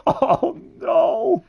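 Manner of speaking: high, hammed-up wail; mock crying. Mocking Alice crying like a little kid, mock wailing. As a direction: fake a little kid's tearful cry.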